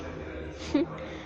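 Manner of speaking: soft breathy laugh under the breath; a small bitter chuckle to herself; quiet laugh. Real, not acted. Laughing to herself at the father's promise, soft laugh. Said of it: "بديت كنضحك ونقول،".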